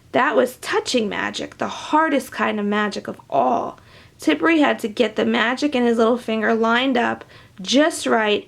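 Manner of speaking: plain reading voice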